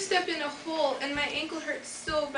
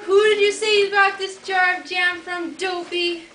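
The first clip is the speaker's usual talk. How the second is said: alarmed shout; 4 semitones higher, 8 dB louder than usual. Acted.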